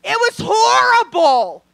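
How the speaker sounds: loud, high, slow horrified shout. Real, not acted. Comic tells the crowd it was awful, loud and slow. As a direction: bellow it like it scarred you.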